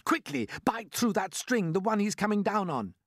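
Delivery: high, urgent call without full volume; urgent yelling. A narrator's reading. James orders the centipede to bite the string, calling urgently. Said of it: "yelled James".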